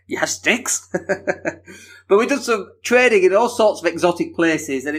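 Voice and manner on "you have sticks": incredulous mock-American question; American accent. American learns police carry sticks, incredulous American accent. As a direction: react as a disbelieving American. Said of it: "that's my American accent".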